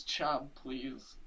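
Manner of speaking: mock-meek little plea; meek retold reply. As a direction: say it softly, giving in at once. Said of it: "And she says".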